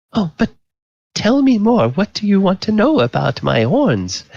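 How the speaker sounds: high-pitched coquette; tipsy flirtatious lady. Acted. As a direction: coo eagerly in a high lady's voice. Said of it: "she says".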